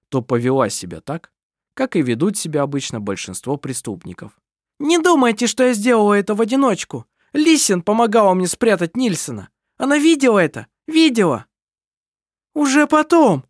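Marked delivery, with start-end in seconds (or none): 4.8-11.5 desperate accusation. A narrator's reading. Kerstin accuses her sister. 12.5-13.4 high, loud cry; loud high protest; shouted retort. A narrator's reading. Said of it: "крикнула Лисин".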